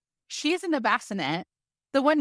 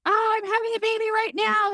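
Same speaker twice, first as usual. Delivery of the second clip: high, loud, hammed-up new mother; squealing parody of a mom in labor; mock panicked mom; 13 semitones higher, 4 dB louder than usual. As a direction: overplay the panicked first-timer. Mocking a dramatic laboring mom.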